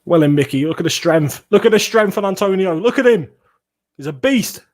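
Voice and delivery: loud, rising football shout; building, high-pitched cheering commentary; excited match reaction. Real, not acted. Live reaction to a player's run.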